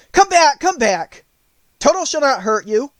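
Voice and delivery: high, loud call after fleeing mice. Acted. The Woodman calls after the scattering mice, loud and high. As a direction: call them back across the grass. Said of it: "he called to the mice".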